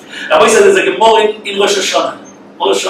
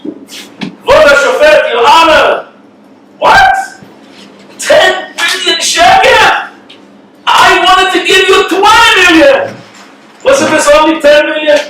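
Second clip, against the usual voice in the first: higher, incredulous protest to the judge; man protesting to the judge, higher and incredulous; agitated outburst; 8 semitones higher, slower than usual. Retold or imitated. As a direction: sputter in disbelief at the tiny sum. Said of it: "he gets very agitated".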